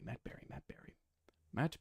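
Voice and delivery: whispered, testing a voice; whispering. Shown as a demonstration. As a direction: breathe the name out. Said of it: "I have his voice in my head".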